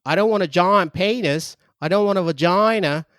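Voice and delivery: hammed-up prank caller giving orders; mock caller demanding a broom on speaker; crude phone caller. S0 role-plays crude phone caller.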